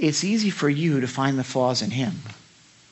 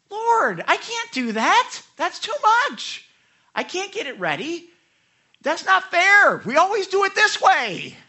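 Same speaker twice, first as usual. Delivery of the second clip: put-on childish tantrum; 13 semitones higher, 8 dB louder than usual. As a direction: act out a mock tantrum at God. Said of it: "I have a little tantrum".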